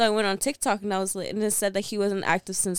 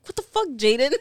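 mock-mad, higher-pitched demand; retold angry outburst; 4 semitones higher, 4 dB louder than usual. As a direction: confront him, pitch raised, half in fun. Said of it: "I was so mad".

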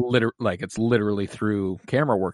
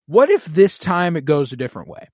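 hopeful wondering; 6 semitones higher, 5 dB louder than usual. Retold or imitated. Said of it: "watching this going".

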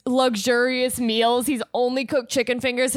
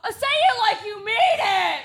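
mock demanding shout; 9 semitones higher than usual. Imitating the host pushing the guy, high mock shout. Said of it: "He goes".

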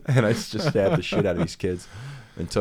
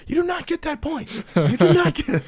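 high squeaky mock scolding voice; hammed-up shrill no, squeaky and loud; 7 dB louder than usual. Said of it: "the bear's somewhere ... going".

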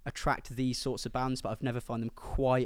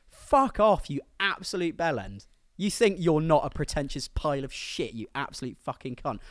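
swearing tirade; 4 semitones higher, 5 dB louder than usual. Real, not acted. Swearing rant at band quote.

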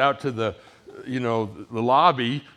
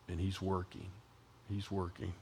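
quiet; 4 semitones lower, 13 dB quieter than usual. Real, not acted. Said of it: "soft closing repetition".

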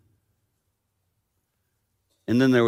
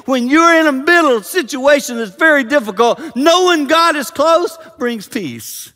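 high, loud preacher's urgency; full-force preaching; 10 dB louder than usual. Real, not acted. Preacher telling congregation that God brings peace, full-force preaching.

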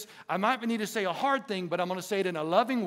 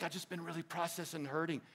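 hurting friend; 3 semitones lower, 12 dB quieter, faster than usual. Retold or imitated. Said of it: "He's like".

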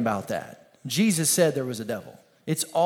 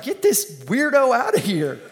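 annoyed king; 6 semitones higher, 5 dB louder than usual. Retold or imitated. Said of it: "the king is like".

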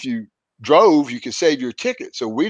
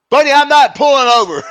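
much higher, louder flat refusal; 14 semitones higher, 8 dB louder than usual. Retold or imitated. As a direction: shout him down, not budging. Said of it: "And I go".

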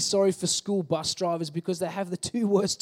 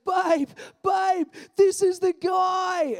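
much higher, louder tipsy shout; drunk excited shout; 10 semitones higher, 5 dB louder, much slower than usual. Retold or imitated. Tipsy guest shouting to girlfriend, much higher and loud. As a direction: call his girlfriend over, thrilled. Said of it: "he goes".

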